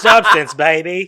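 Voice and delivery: slurred swinging-sixties London drawl; Austin Powers impression. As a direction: play it like a cheeky spy. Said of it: "that was Austin Powers".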